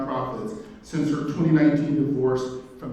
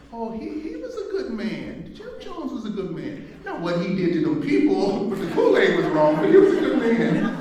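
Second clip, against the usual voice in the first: put-on much higher, laughing Kentucky old-lady voice; a Kentucky grandmother, chuckling and rising to a loud finish; Kentucky grandma accent; 9 semitones higher, 5 dB louder than usual. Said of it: "in her Kentucky originally accent".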